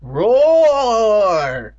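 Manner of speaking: long loud high mock roar; monster roar. The monster blood roaring at the boys, hammed-up roar. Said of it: "emitted a deafening roar".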